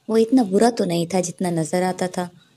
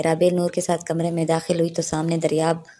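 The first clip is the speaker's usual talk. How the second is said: usual speaking voice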